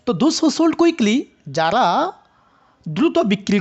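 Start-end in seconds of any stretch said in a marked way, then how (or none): 0.1-1.2 "sold quickly" pitched high as one group; slow, raised "sold quickly"; rising intonation demo. Shown as a demonstration. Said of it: "আমরা যখন সোল্ডের সাথে কুইকলিকে একই সাথে rising".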